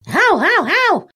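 high-pitched imitated dog yaps; dog barking. A narrator's reading. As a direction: yap like a little dog, over and over. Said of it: "не переставая лаяла". (звонкий собачий лай)

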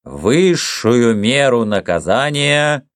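slow, high, measured courtroom reading; pronouncing a sentence. A narrator's reading. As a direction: hand down the sentence, word by word.

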